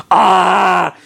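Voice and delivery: high, loud "Ah!" cry; yell. A narrator's reading. Jigglypuff reacting to burning curry, high yell. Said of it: "Jigglypuff yelled".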